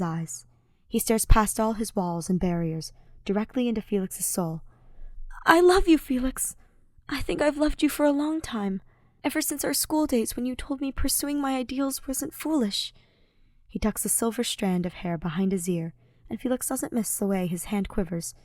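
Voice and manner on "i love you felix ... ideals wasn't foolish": put-on higher, gentle young man's voice; Ash's earnest voice. A narrator's reading. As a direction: voice Ash pleading sincerely before battle. Ash confesses love.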